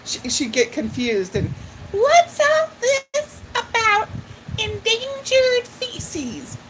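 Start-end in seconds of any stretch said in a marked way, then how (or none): 1.9-6.6 put-on high, slow, puzzled little old lady; imitating a befuddled old lady, high and drawn out; Gilda Radner's old lady. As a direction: play a confused lady who is hard of hearing, pitched way up and slow. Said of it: "is a little hard of hearing as this little old lady".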